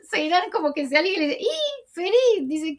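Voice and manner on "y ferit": high-pitched indignant "¿Y, Ferit?", voice jumping way up; mock outrage that he isn't listening, pitched high. Seiran scolding a distracted Ferit, high and indignant.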